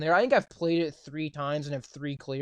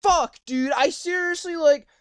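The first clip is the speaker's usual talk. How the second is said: higher, louder fed-up ranting; 6 semitones higher, 5 dB louder than usual. Real, not acted. Complaining to friend he can't drive, loud fed-up rant. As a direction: shout your frustration. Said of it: "I'm tired of it".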